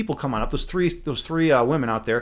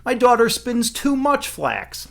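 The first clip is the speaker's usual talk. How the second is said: exasperated complaint; 8 semitones higher, slower than usual. Acted. Mother makes up a complaint.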